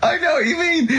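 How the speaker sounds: high, laughing delivery; real laughter through the words. Real, not acted. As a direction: say it while still cracking up.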